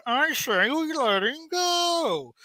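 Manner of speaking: high, drawn-out comic impression; Pee Wee Herman. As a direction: stretch it out in a goofy high voice. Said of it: "I could imagine Pee Wee Herman saying".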